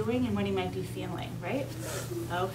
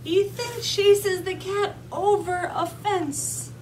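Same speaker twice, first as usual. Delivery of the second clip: high, loud, slow storybook excitement; dramatic read-aloud; 7 semitones higher, 5 dB louder, much slower than usual. A narrator's reading. Teacher reading the chase to young children, animated storytelling. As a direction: build the chase word by word.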